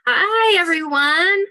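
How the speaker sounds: excited greeting. Real, not acted. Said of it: "I'm so excited".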